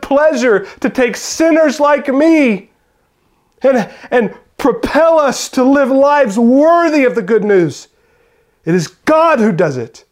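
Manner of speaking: loud, high, ringing preacher's voice; impassioned sermon climax; full-force preaching. Acted. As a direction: preach it out to the whole room.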